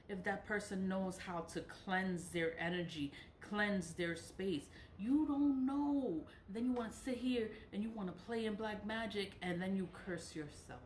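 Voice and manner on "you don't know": high, loud, slow "You don't know"; insistent emphasis. Real, not acted. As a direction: drive each word home slowly. Insisting in her rant.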